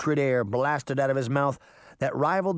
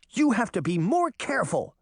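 high-pitched worried scolding; mildly cross, high-voiced telling-off; 8 semitones higher than usual. A narrator's reading. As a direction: scold a little sister in a higher voice. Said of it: "Sabrina scolded".

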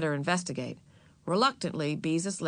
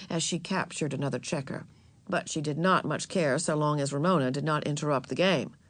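ordinary delivery

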